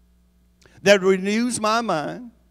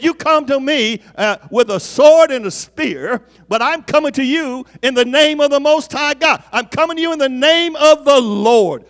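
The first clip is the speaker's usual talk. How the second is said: defiant cry; 6 semitones higher, 6 dB louder than usual. Acted. David defies Goliath in sermon.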